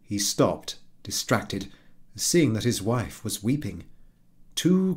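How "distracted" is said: plain reading voice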